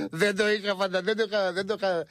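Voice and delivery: laughing. Retold or imitated. Retold friend's laughing reply.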